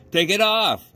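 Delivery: put-on high, loud boy's shout. A narrator's reading. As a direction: egg your brother on. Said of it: "shouted Amos".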